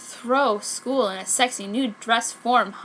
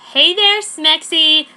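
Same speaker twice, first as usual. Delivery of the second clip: sleazy catcall; 6 semitones higher, 10 dB louder, slower than usual. Acted. Prep catcalling Sakura.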